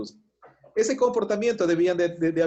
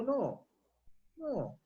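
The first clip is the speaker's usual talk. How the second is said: hushed, higher gentle no; soft refusal; 7 semitones higher, 9 dB quieter than usual. Retold or imitated. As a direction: refuse tenderly, barely aloud. Daniel refuses the king. (negativa suave y baja)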